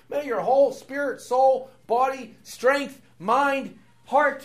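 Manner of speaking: loud, high, mock-emphatic stacking of terms; mock escalating list. Preacher mocking the piled-up terms to his listeners, loud and high. Piling extra terms onto Paul's verse.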